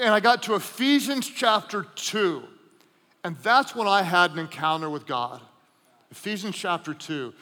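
ordinary talk, nothing marked

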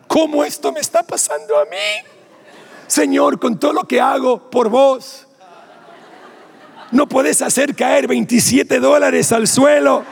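hammed-up outrage aimed at heaven; frustrated plea. Frustrated complaint to God.

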